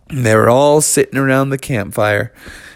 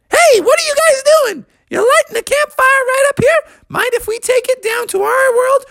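high mole voice. Acted. Moles pop out.

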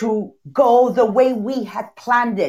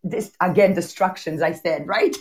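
ordinary talk

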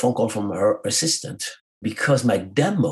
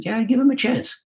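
kind producer. Retold or imitated. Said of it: "He said".

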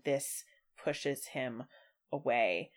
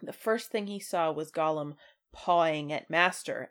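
normal talking voice

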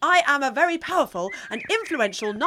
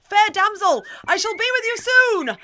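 raised, higher heroic call; hammy stage actor; 5 semitones higher, 4 dB louder than usual. Acted. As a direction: declaim it like a stage knight. Knight calls out.

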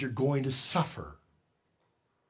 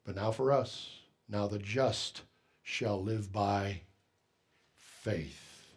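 much softer and lower, slowing; solemn hush; 7 semitones lower, 7 dB quieter, slower than usual. Real, not acted. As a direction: let faith fall to a near whisper. Solemn quotation, dropping low.

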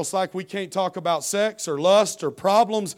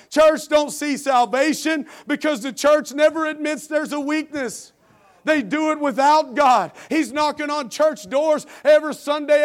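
rising, louder sermon voice, building to full force; preaching; 7 semitones higher, 5 dB louder than usual. Acted. Pastor calls out the proud church to his congregation, fervent preaching. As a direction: preach it, pushing harder line by line.

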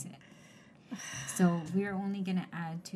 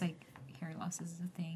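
whispered aside; 9 dB quieter than usual. Real, not acted. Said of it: "repeated phrase".

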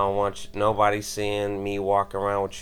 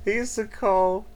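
laughing; 7 semitones higher, 6 dB louder than usual. Real, not acted. Laughing while retelling friend's slang.

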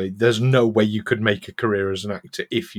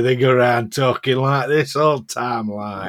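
broad Barnsley accent. Shown as a demonstration. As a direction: lay on a thick Barnsley accent. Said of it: "but people from Barnsley".

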